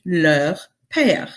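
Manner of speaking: "leur père" in French, rounded eu; French pronunciation. Shown as a demonstration. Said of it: "it would be".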